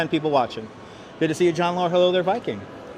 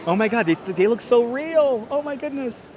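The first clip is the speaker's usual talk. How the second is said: amazed gushing; 6 semitones higher than usual. Real, not acted. Marvelling at lifelike wax figures.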